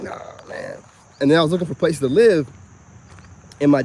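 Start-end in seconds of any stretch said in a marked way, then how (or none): 0.0-0.8 breathy aside. Retold or imitated. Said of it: "And I'm like".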